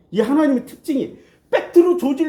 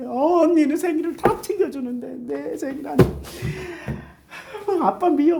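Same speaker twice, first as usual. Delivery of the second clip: whiny daughter; 5 semitones higher than usual. Retold or imitated. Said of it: "덕선이가 땅을 치면서 울잖아".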